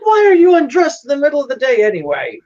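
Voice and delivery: high, loud mock indignation; hammed-up parental outrage; scolding father. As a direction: play an exasperated dad demanding answers. Father scolds undressed daughter.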